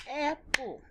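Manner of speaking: "Apple" split into two stretched syllables. Shown as a demonstration. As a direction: clap out Ap-ple slowly. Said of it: "So when we say apple".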